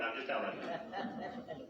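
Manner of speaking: soft, slow, chuckling through the words; laughing aside. Real, not acted. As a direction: laugh it off quietly. Laughing aside about morning sickness.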